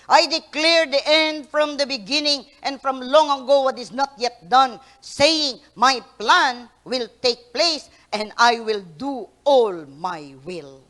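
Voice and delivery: loud, slow divine declaration; booming scripture reading. Acted. Preacher reading Isaiah verse ten loudly. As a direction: read it as God's decree.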